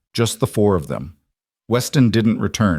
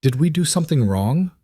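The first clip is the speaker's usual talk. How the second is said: higher, lightly voiced worried little girl; small, frightened child; 7 semitones higher than usual. A narrator's reading. Daughter fears she did wrong, small frightened voice. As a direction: ask it high, a touch afraid. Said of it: "Molly's voice was small, frightened".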